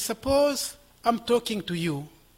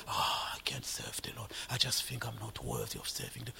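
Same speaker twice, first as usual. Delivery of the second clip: much softer, lower, whispered lament; mock hopeless believer; 9 semitones lower, 14 dB quieter than usual. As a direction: sigh it out like someone defeated. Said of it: "You did this, this, and that".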